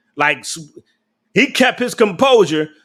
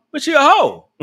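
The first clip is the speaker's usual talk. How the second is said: laughing it out, pitch jumping higher; 7 semitones higher than usual. Real, not acted. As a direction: giggle through the words. Laughing while calling her out.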